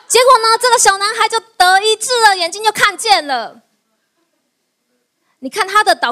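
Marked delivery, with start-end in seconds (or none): 0.1-3.6 much higher and louder, triumphant; thrilled pulpit proclamation; excited climax. Real, not acted. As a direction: announce the miracle with joy. Climax of the healing story.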